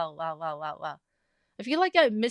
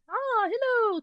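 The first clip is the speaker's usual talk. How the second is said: excited hello; 14 semitones higher, slower than usual. Real, not acted. Greeting viewer Karin.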